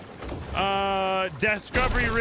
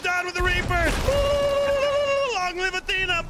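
high, excited crowing; rallying shout; 6 semitones higher, 4 dB louder than usual. Real, not acted. Player cheering Athena over the Reapers, rallying shout. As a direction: shout it like a victory slogan. Shouts slogans after the win.

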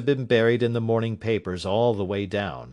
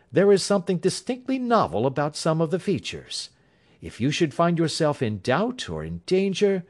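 higher, assured detective voice; Holmes; 8 semitones higher than usual. A narrator's reading. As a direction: Sherlock Holmes reassuring his worried client, higher assured voice. Holmes voiced reassuring his client.

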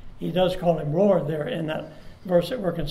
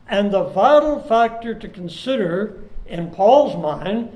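louder, swelling sermon delivery; preaching; 4 dB louder than usual. Acted. Pastor urging congregation about the coming Lord, full preaching. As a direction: drive the point home from the pulpit.

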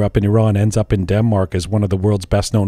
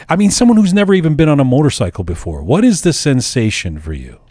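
ordinary talk

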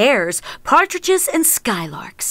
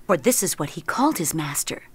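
whispered aside; 5 semitones lower, 5 dB quieter, faster than usual. A narrator's reading. Aside in narration.

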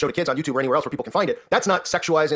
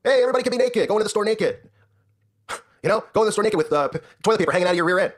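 sarcastic mockery; 5 semitones higher than usual. Sarcastic mock of permissive society.

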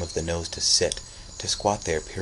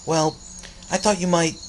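put-on higher, fast pleading boy tumbling out words; rapid flow; 7 semitones higher, faster than usual. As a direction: rush it all out in one breath. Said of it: "in one long, rapid flow".

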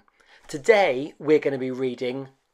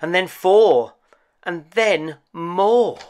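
higher, louder and slower counting to a peak; building excitement; 6 semitones higher, 7 dB louder, slower than usual. A narrator's reading. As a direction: swell the count as the rabbits pour in. Counting the rabbits as they appear.

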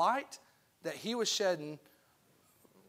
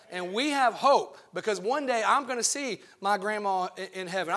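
louder, rising preacher's call; fiery preaching; 7 dB louder than usual. Acted. Preacher to his congregation, preaching with rising force. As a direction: preach it out with full conviction.

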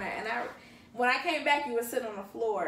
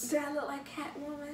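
whispering; 5 semitones higher, 5 dB quieter than usual. Retold or imitated. Whispering at party.

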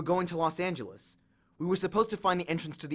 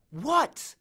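put-on higher, snappish girl's voice; annoyed girl; 9 semitones higher than usual. A narrator's reading. Annabeth snapping at Percy who shook her. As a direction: snap back, distracted.

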